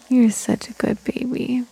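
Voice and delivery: whispered, soft praise; whispering. Acted. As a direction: breathe it to a sleepy baby. Whispered to a drowsy baby.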